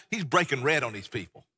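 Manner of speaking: ordinary delivery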